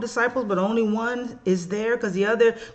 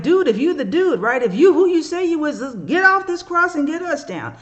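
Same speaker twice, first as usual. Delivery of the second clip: jeering, higher and louder taunt; mocking criminal; 6 semitones higher, 5 dB louder than usual. Criminal on the cross taunting Jesus, loud and jeering. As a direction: taunt him from the next cross. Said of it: "one of the people who's being executed is like".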